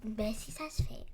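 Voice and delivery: soft whispered tease; whispering. Real, not acted. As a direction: whisper it back teasingly. Teasing whispered reply.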